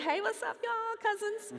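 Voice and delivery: playful shout-out. Pretend. Greeting her New Zealand cousins from the stage, playful shout-out. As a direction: sing out hello to distant cousins.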